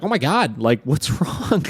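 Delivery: retold alarm, high and loud at first; alarmed concern. Husband woken by his wife's yell, retold alarm. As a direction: imitate a startled, worried reaction. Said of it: "sounded so concerned".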